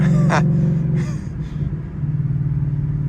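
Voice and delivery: high squeaky laugh that breaks; cracking laugh. Real, not acted. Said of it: "and my voice is cracked".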